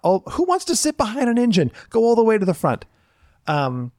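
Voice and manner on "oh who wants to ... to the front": bossy skipper herding guests. Retold or imitated. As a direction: shoo the guests to the front like a tour guide. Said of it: "I'm like".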